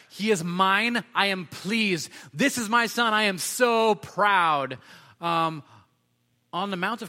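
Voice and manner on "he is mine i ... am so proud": higher and louder, beaming declaration; proud God. Retold or imitated. God proclaiming his son on the mountain, loud and slow at the end. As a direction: announce it like a proud father.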